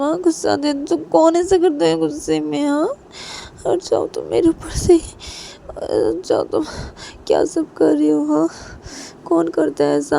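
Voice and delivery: high-pitched, indignant scolding; acted angry wife. A narrator's reading. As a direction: scold him and order him off. Wife angrily orders him off her. (गुस्से में ऊँची आवाज़ में डाँट)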